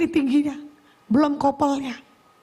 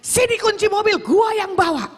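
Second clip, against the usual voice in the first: higher-pitched, stubborn demand; insistent; 7 semitones higher than usual. Retold or imitated. Arguing with younger sibling over car keys, insistent. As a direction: re-enact arguing hard for the keys. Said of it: "Sambil saya ngotot sama adik saya".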